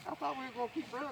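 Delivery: high, fast little voice for the cow; playful cow voice. Retold or imitated. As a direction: squeak it out quick like a breathless cow. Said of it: "She says".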